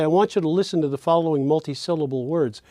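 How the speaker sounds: quick connected flow, syllables run together as one idea; talking fast. Shown as a demonstration. Lecturer giving an example instruction to listeners, fast and flowing. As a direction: rattle it off as a single fluent phrase. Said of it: "I'm talking too fast".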